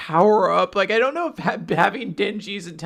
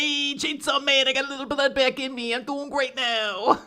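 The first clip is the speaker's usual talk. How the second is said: higher, smug, breezy voice; cocky teasing voice; 5 semitones higher than usual. Retold or imitated. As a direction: tease her like a cocky punk. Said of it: "He's like".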